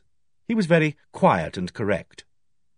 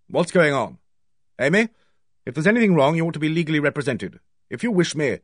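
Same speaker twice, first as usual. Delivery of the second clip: brisk, higher-pitched sharp intervention; sharp demand; 4 semitones higher, faster than usual. A narrator's reading. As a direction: cut in sharply and urgently. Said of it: "He said sharply".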